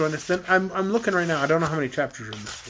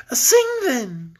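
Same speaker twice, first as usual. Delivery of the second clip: higher, firm royal command; 6 semitones higher, 4 dB louder than usual. A narrator's reading. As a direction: order the captive to perform. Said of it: "commanded Queen Cor".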